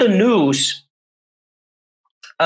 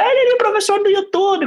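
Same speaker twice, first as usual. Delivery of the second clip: much higher, quick teasing passerby; mocking passerby; faster than usual. Passerby spotting the YouTube teacher, high mocking voice. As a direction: tease the teacher from the street, high and fast. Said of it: "você olha".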